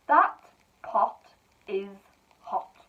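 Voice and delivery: each word set apart and clear; slow dictation. Shown as a demonstration. Teacher dictating to pupils, slow and clear. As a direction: dictate slowly to young writers.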